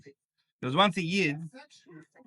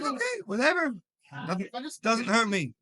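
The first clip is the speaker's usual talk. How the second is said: casual shrug; 5 semitones higher than usual. Retold or imitated. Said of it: "he was like".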